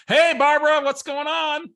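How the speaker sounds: high, loud, overly bright hello; fake cheerful greeting. Driver greets a caller he can't place, overly bright. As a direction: sell it like an old friend.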